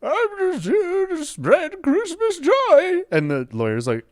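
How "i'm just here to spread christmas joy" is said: very high, very slow sugary voice; saccharine Santa. Acted. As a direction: drip fake holiday cheer. Santa pleading in court.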